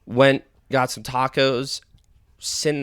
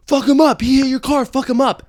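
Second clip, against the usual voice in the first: high-pitched, loud crowd yell; mock bystander shout, pitched way up; imitated distant hollering; 12 semitones higher, 7 dB louder than usual. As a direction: holler it like egging on a fight. Imitating bystanders calling from afar.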